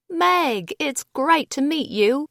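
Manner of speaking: put-on chirpy London girl greeting a newcomer; British accent. Floor advisor greets new girl, British accent. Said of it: "Her accent was delicious".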